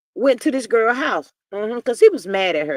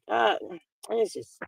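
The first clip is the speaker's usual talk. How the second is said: ordinary talk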